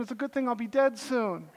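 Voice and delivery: normal talking voice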